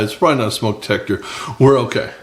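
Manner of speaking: ordinary talk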